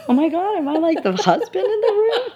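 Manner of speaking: very high, squealing through laughter; high giggling mock horror at the moms' class; laughing shocked squeal. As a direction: squeal it while cracking up. Retold own shocked thought.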